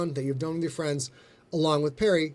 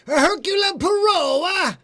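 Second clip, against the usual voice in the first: big, slow, high hammed-up Italian accent, loud; 13 semitones higher, 6 dB louder, slower than usual. Host showing off an Italian accent to the audience. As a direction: belt the name like a stage Italian. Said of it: "I know how to do an Italian accent".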